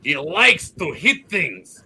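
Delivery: emphatic Russian-accented dwarf. Shown as a demonstration. Player demonstrating his barbarian dwarf, Russian accent. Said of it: "Russian dwarf".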